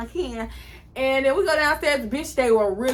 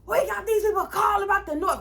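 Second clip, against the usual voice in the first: staff shouting; 9 semitones higher than usual. Retold or imitated. Hotel staff ordering the noisy kids out of the pool, shouting. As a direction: yell at the kids to get out. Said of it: "they was like".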